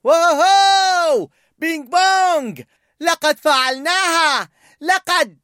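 high, loud, stretched whoop of joy; happy cheer. A narrator's reading. Joy cheers at reaching the edge, joyfully. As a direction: cheer like you just won. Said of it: "هتفت فرح بسعادة".

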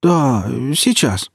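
high, drawn-out nervous bleat; bleating reply. A narrator's reading. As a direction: bleat it out, stretching the words. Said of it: "Проблеял".

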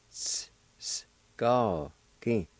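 Ts, s whispered, then ga, gi voiced, each apart; split into sounds. Shown as a demonstration. Teacher breaking the word into its sounds. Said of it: "like this".

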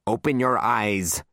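slow, firm command; ordering. Acted. Librarian to the cowering child, commanding. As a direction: give a drawn-out order. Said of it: "she ordered".

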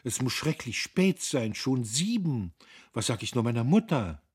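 höher und lauter, aufgeregt; in Sorge wegen der Mutter. A narrator's reading. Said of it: "rief Guste plötzlich". (aufgeregt, höher gesprochen)

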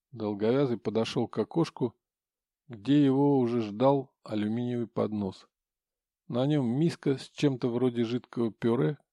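plain reading voice

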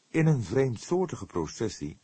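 plain reading voice

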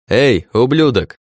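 loud slow taunting call; shouted challenge. A narrator's reading. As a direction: bark it across the cave. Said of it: "на окрик Дума".

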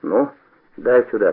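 ordinary delivery

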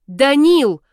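high, loud yell of the name. A narrator's reading. Heroine calling out to partner in pain, loud yell. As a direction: yell out in protest. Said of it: "Ору".

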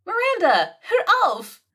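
hoch und laut: hör auf!; verzweifelter Ausruf. Acted. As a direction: schreit sie an, damit sie endlich aufhört. Andy unterbricht Miranda im Streit. (unterbricht ihre Chefin mit einem hohen, lauten Ausruf)

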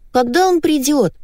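put-on high-pitched child's squeak; squeaky little girl. A narrator's reading. Little girl on television, squeaking. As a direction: pipe up like a small girl. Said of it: "запищала маленькая девочка".

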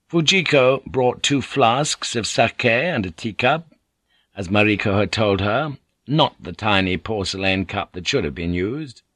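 usual speaking voice

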